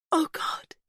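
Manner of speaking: high, breathless gasp; gasped fear. A narrator's reading. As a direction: gasp it out in panic. Pippa in pain.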